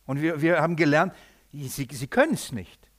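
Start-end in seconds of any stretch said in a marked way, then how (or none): none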